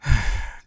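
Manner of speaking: exasperated sigh. Acted. Exasperated at the hero.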